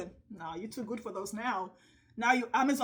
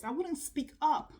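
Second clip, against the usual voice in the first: normal talking voice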